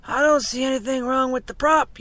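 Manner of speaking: loud shout across the boat; yelling. A narrator's reading. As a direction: yell down to someone below deck. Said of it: "yelled one man to someone below".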